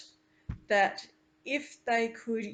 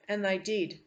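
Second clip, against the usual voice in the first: usual speaking voice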